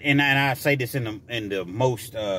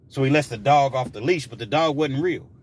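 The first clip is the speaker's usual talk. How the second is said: ordinary talk, nothing marked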